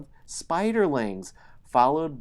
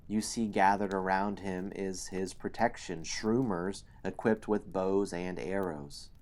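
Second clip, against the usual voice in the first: normal talking voice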